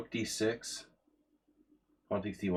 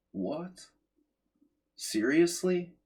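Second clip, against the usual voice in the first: 1 disbelief; 10 semitones higher, much slower than usual. Real, not acted. Shocked at opponent's pawn capture.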